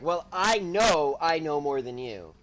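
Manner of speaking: slow, low comeback leaning hard on the first "know"; deliberate, low-pitched retort, punching "know"; stress on KNOW. Retold or imitated. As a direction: draw out "I know" as a retold one-up. Retold comeback, stressing "know" over "think.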